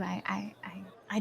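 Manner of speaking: shy, breathless whisper. Acted. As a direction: whisper it, almost afraid to speak. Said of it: "she says".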